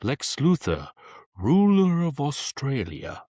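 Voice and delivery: slow, high villain voice; Zod impression. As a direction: declare it like a grand tyrant. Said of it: "I loved quoting Zod".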